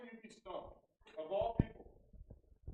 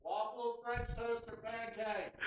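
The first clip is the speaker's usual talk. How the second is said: higher-pitched, sustained delivery of the choices; with some oomph; 6 semitones higher, much slower than usual. Shown as a demonstration. Player shows host how to announce choices, with oomph. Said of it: "say it with some oomph".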